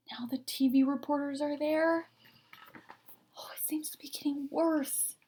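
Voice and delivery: hushed storyteller whisper; whisper aside. A narrator's reading. Reading to a child, whispering an aside.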